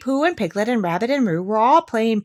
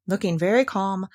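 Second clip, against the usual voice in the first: plain reading voice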